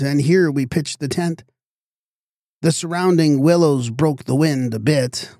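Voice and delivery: ordinary delivery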